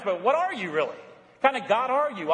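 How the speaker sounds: ordinary talk, nothing marked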